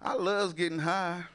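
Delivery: high-pitched imitation of an old grandma; playful mock-grandma voice. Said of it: "Ain't that some grandma shit".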